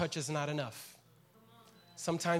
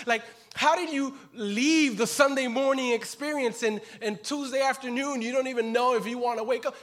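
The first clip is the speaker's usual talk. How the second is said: rising, louder impassioned questioning; preaching full force; 5 semitones higher, 7 dB louder than usual. Acted. Preacher voicing God's question to Elijah, impassioned and loud. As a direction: press the question harder and harder.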